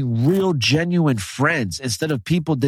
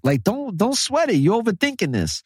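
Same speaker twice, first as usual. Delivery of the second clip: higher, lightly put-on voice brushing off her worry; mock reassuring boyfriend; 7 semitones higher than usual. Retelling a movie to a friend, high mock reassurance. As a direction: imitate a breezy boyfriend saying don't sweat it.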